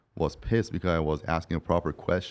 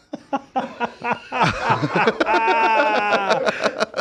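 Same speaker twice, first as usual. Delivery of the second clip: long high burst of laughter; 4 dB louder than usual. Real, not acted. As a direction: laugh hard at the punchline. Laughing after the apology story.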